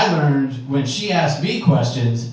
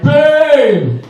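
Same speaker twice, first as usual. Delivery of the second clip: loud high call of babe; yelling for help; 10 semitones higher, 7 dB louder than usual. Retold or imitated. Spouse stuck without socks yelling for partner, loud call. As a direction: holler from the bedroom. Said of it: "one of us yells from the bedroom".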